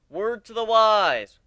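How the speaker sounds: slow, loud emphatic warning; stressed warning. Acted. Tour guide warning the ponies, slow and loud. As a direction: lean hard on each word.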